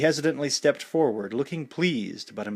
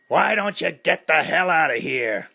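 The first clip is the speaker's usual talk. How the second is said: angry shout, louder; screamed; 5 semitones higher, 5 dB louder than usual. A narrator's reading. Mickey ordering Clubber away, shouting. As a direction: bark it at the intruder. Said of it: "Mickey screamed".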